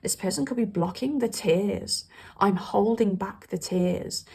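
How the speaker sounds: ordinary talk, nothing marked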